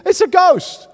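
a high, loud cry of fright at a ghost; terrified cry. Retold or imitated. Fishermen in a storm crying out at the ghost, in high loud fright. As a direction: shout it like a terrified disciple. Disciples terrified seeing Jesus.

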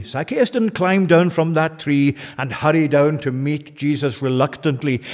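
ordinary talk

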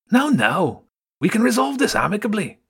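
high, quick smooth rat voice; soothing the standoff; calm axe rat. Acted. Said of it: "the middle axe rat said calmly".

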